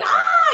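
short high-pitched excited squeal; high imitation scream, not full volume; retold scream. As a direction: mimic screaming at a celebrity sighting. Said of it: "I'm screaming".

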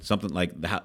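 usual speaking voice

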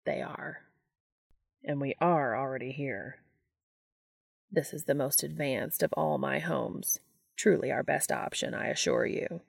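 quietly level, unhurried; lightly voiced calm angel. Castiel answering Dean on the forest floor, lightly low and soft.

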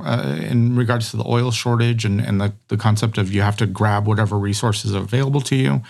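normal talking voice

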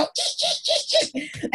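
high squeaky imitation of skidding wheels; cartoon screech. Storyteller mimicking wheels screeching to a halt, cartoon squeal. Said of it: "screeching to a halt, like a cartoon".

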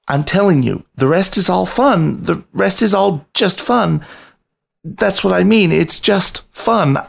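high, small, earnest voice explaining his limit; a nervous young rat begging the photographer and panther; timid rat pleading. A narrator's reading. Biscuit pleading with two cats.

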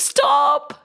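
high, urgent pleading wife; high pleading wife begging the ogre. Acted. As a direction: beg the demon to spare her husband. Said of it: "the wife of the Brahmin stopped him".

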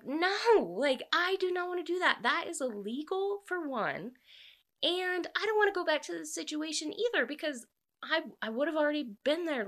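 high-pitched, indignant retold refusal; imitating an exasperated woman turning someone down; emphatic refusal. Retells heroine's firm refusal of proposal.

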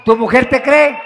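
loud mocking retort. Real, not acted. Repeats the jab louder.

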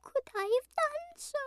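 tiny, very high lamb voice; pleading lamb. A narrator's reading. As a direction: plead helplessly, high and meek. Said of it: "pleaded the lamb".

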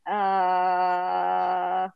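long drawn-out "uh" of hesitation; reluctant balking. Acted. Believers told to get in the wheelbarrow, hesitant refusal. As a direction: stall on the uh, then back out.

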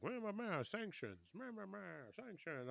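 high sing-song meh meh meh; mock the officials' droning with a whiny tone; mocking blah blah. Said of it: "whenever they were like".

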